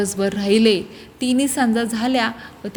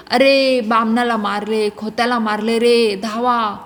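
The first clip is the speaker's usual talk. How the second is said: ordinary delivery, nothing marked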